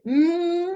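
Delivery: "mm" as a long, high, loud hum; isolated M sound. Shown as a demonstration. Teacher showing M cannot stand alone, long hummed "mm". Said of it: "Yo no puedo decir".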